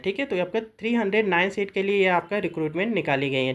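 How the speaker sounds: usual speaking voice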